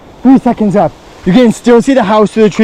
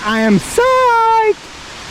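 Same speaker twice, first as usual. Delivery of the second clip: excited; much slower than usual. Real, not acted. Hiker hyped in rain.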